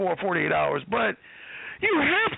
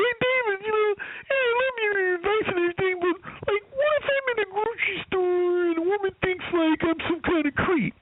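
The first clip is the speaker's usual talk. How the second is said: imitating a nervous, whiny student, higher; timid student; 12 semitones higher than usual. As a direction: plead like an anxious guy worried he seems creepy. Timid student asks David a question.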